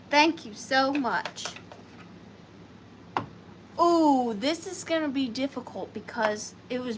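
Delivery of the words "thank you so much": ordinary talk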